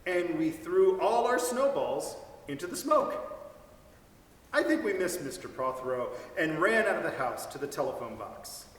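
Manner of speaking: plain reading voice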